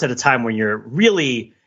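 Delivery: normal talking voice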